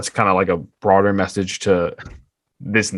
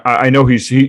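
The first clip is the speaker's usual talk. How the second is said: ordinary talk, nothing marked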